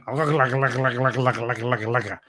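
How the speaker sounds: lightly mimicked made-up Gaelic babble; a hint of nonsense foreign chatter; mock gibberish. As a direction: rattle off mock gibberish, fading at the end. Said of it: "the way he talks".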